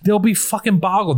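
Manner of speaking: ordinary talk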